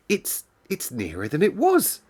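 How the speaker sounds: high, halting, a little scared; nervous girl. A narrator's reading. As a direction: stammer it out, heart racing. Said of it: "her heart beating rather faster".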